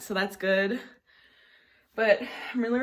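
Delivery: ordinary talk, nothing marked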